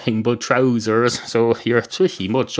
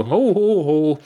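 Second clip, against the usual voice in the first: hammed-up ho ho ho, much higher; mock laugh; 7 semitones higher, slower than usual. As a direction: fake jolly laugh at his own quip. Customer laughs at own joke.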